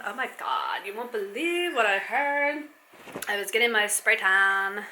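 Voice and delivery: hammed-up Californian valley girl, vowels stretched out; faux valley girl. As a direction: put on a gushing valley girl accent. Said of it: "It's the inner California girl in me".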